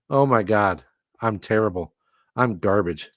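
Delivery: ordinary talk, nothing marked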